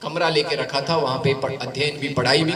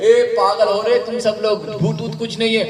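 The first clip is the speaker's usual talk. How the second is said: higher, louder mocking call; scoffing boy; 7 semitones higher, 4 dB louder than usual. Narendra scoffs at his scared friends from the tree. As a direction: laugh off their fear.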